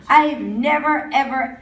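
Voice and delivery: usual speaking voice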